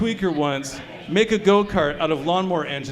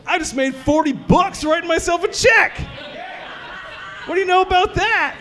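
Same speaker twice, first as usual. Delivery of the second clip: triumphant shout; 10 semitones higher than usual. Acted. Comic bragging to the crowd, shouting high and proud. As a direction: crow about the forty bucks like a winner. Triumphant punchline about profit.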